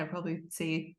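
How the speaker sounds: usual speaking voice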